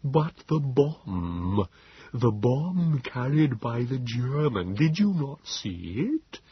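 high, very slow, pleading creature voice; Nebogipfel. A narrator's reading. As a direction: labor over each word, drawn out and urgent. Wheezing Morlock warns of bomb.

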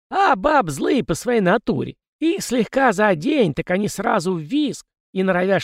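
put-on high, cranky old ghost; a fussy old spirit lecturing. Ghost Kozyrev's voice. (ворчливый старый призрак)

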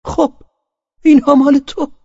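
very high, loud parting shot; piercing, emphatic retort; very firm voice. A narrator's reading. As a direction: hurl it at him forcefully. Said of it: "با صدای بسیار محکمی".

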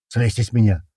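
mildly strained grunt; grunting protest. A narrator's reading. Man pinned under fallen comrades, grunting in protest. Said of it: "кряхтит снизу Туман".

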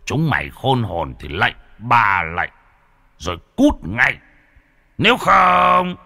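put-on old exorcist threatening ghosts, ending in a shout; grandpa threatening ghosts. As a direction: bark an ultimatum at the demons. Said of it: "Trong lúc ông cụ đang ra oai".

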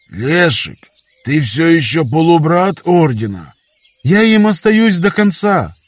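a fervent cry to his father; calling out loud. A narrator's reading. Father and son call out.

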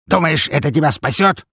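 high, loud thug voice; menacing bandit boss. A narrator's reading. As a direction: sneer at them threateningly.

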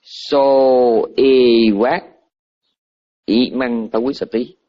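slow, drawn-out Pali words, "Xô y quát" stretched; Pali sutra phrase. Shown as a demonstration. Monk quoting the sutra to listeners, slow Pali recitation. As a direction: intone the Pali "Xô y quát, y măng tao quý sa tí" slowly and clearly. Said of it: "trong này gọi là".

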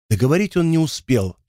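ordinary delivery

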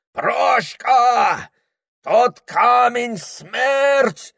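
high slow shout over the roar. Acted. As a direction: warn him over the thundering water. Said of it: "беззвучно кричал и Ибрагим". (крик сквозь шум)

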